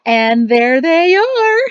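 high, slow, delighted squeal. Acted. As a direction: squeal it out to the crowd, drawn out. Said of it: "his mother-in-law squealed".

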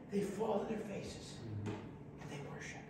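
hushed, breathy whisper; hushed worshipful whisper; whispered reverence. Acted. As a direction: whisper it in awe. Reading elders falling to worship.

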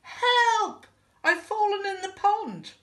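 high, loud call of distress; theatrical cry for rescue; calling for help. A narrator's reading. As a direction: shout it high, as if in trouble. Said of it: "called the voice".

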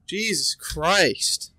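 usual speaking voice

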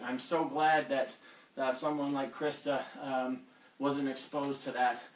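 ordinary delivery

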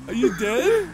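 normal talking voice

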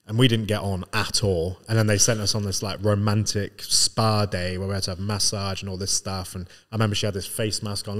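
usual speaking voice

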